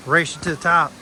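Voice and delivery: loud, teasing dare; playful challenge. Real, not acted.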